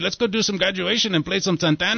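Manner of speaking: a hammed-up Mexican accent urging the kids on; mock Mexican accent. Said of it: "you are saying it".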